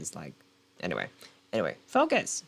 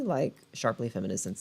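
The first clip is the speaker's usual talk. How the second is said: ordinary talk, nothing marked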